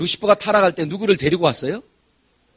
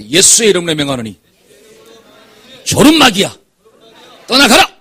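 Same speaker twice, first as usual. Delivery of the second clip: commanding rebuke; 9 dB louder than usual. Shown as a demonstration. Leads congregation in rebuking drowsiness demon. (귀신을 쫓듯 점점 크게 외치는 명령)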